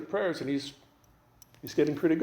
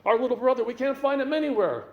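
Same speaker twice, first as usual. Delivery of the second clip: high-pitched frantic wail; hammed-up panic over someone lost; plead, pitch climbing as you go; excited, nervous; 7 semitones higher, 3 dB louder, faster than usual. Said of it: "most excited voice, most nervous voice".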